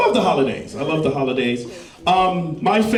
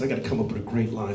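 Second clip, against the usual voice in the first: quick, low, soft muttering; muttered thought; 9 semitones lower, 9 dB quieter, much faster than usual. Retold or imitated. Teen boy plotting a pickup line, muttering quickly. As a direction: rush it under your breath.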